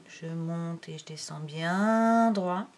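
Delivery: slow, with a long held "bien"; drawn-out demonstration. Shown as a demonstration. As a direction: stretch "bien" as the pen travels straight down. Parole calée sur le tracé. (lentement, « bien » très étiré)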